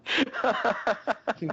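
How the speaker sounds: bright high burst of laughter. Real, not acted. Laughing at Russian bit.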